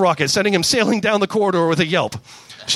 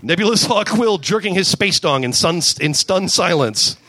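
plain reading voice

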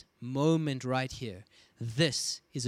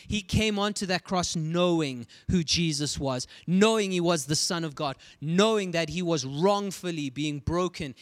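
higher and louder preaching; building preaching; 4 semitones higher, 5 dB louder than usual. Acted. Preacher urging the congregation with rising force. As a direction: drive the point home.

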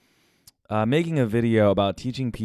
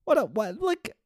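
voice going high; 12 semitones higher, 3 dB quieter than usual. Real, not acted. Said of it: "why is my voice going high".

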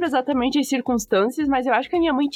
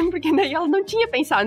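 usual speaking voice

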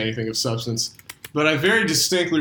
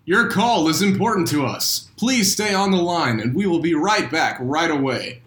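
phone menu voice; 4 semitones higher than usual. Pretend. Hold message repeated.

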